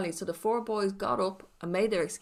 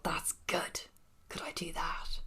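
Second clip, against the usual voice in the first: whispered thought; 6 semitones higher, 9 dB quieter than usual. Retold or imitated. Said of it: "I thought".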